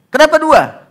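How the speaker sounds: high, loud demand; father yelling why at his son; exasperated father. Retold or imitated. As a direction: shout the question at the child.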